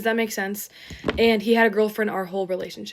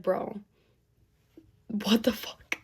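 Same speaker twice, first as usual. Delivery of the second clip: hushed, breathless disbelief; quiet shocked hush; stunned whisper; 6 dB quieter, slower than usual. Retold or imitated. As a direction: whisper it like you can't believe it. Stunned reaction to cheating news.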